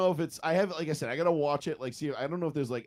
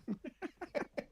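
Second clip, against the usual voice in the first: high squeaky laugh; 9 dB quieter than usual. Real, not acted. Laugh after joke.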